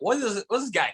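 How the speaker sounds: high-pitched baffled audience member; puzzled onlooker. Retold or imitated. Confused crowd asking about the comic, high baffled voice. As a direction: ask it high, in mock bewilderment.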